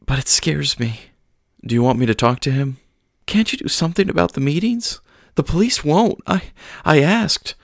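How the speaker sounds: high, halting, frightened speech; tearful worry. Acted. Frightened mother explains the meetings, tearful and halting. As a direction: stammer through the fear. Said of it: "She started sobbing again".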